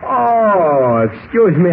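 long drawn yawn into the words; bored yawn sliding down in pitch. Acted. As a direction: yawn through it, starting high and sinking. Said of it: "I was yawning at the time".

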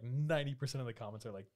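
normal talking voice

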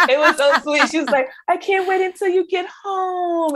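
high, laughing imitation of a teary mom; mom's high, teary, laughing voice. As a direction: gush with teary joy. Mother crying with joy, laughed.

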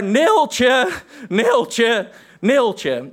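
toned-down theatrical bellow, high; calling out Neeltje. Retold or imitated. Actor bellowing the name across the stage, toned down. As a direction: call the name out, fading each time. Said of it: "veel harder dan ik het nu hier zal nadoen".

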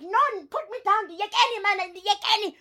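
put-on high, childish protest at being carried; upset little girl. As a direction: squeak it like a cross little girl who wants down. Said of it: "She's like".

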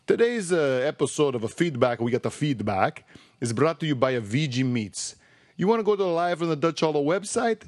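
hammed-up Italian ad read; comic Italian salesman with a singsong lilt; Italian accent. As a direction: pitch it in a put-on Italian accent. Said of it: "That was my Italian accent".

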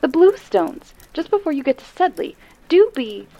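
rushing out the directions in alarm; agitated woman. Acted. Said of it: "in an agitated voice".

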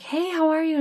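high, bright put-on greeting; fake cheerful. Greeting the client she feared, forced cheerful hello. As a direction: cover the panic with forced cheer.

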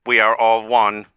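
measured, mechanical pronouncement; robotic AI character. Pretend. AI telling the host it is in Dylan, robotic. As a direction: speak as a solemn little machine. Plays an AI living inside Dylan.

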